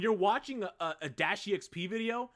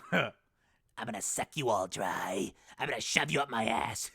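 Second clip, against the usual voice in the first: Imperfect Cell; 6 semitones lower than usual. Acted. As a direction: menace them as a creepy creature. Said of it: "Like, like Imperfect Cell".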